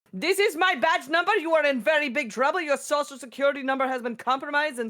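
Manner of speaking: high, loud, bossy threat; aggressive fake cop. Imitating the fake police scammer, high and bossy. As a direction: bark it like a bullying fake officer. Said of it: "dommy police officer impersonator who's like".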